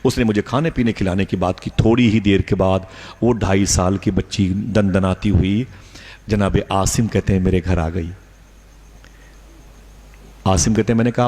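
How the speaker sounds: ordinary talk, nothing marked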